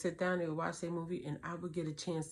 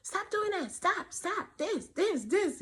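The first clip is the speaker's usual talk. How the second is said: high, louder mock scolding; frustrated mom yelling; 13 semitones higher, 5 dB louder, slower than usual. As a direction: snap at the kids like a worn-out mom. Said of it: "I'm gonna be like".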